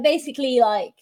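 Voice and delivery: ordinary delivery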